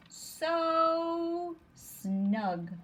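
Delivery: high, stretched so snug; lightly voiced cosy bug. Teacher voicing the bug in its cosy home. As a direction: savour so snug like a contented bug. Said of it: "says the bug".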